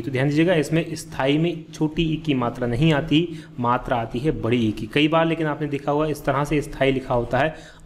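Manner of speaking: normal talking voice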